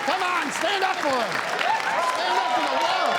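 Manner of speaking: high, cheering call to the couple; excited cheering. Real, not acted. Host urging the crowd to cheer the couple. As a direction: whoop them on like a game-show host.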